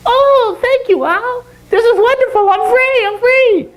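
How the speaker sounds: very high squeaky monkey voice; grateful, excited falsetto monkey; overjoyed monkey. Acted. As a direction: squeal with relief as a little monkey. Freed monkey thanks owl.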